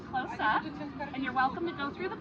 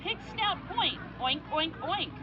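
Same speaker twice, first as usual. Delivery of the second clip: put-on reading to a toddler; 3 semitones higher, slower than usual. A narrator's reading.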